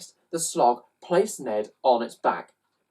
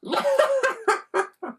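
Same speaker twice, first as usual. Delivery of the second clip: very high mocking cat laugh; cats' high mocking laugh. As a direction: cackle at the pitiful dog. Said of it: "they actually laughed".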